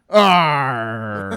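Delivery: loud, long, high pirate roar; pirate captain. Acted. Captain coming out of his cabin, roaring to his crew. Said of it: "the captain would come out".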